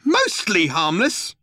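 high, slow outraged shout of Mostly harmless. A narrator's reading. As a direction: yell the question in disbelief. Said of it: "shouted Arthur".

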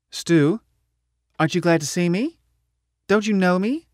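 teasing, bright greeting; Wyo's voice. A narrator's reading.

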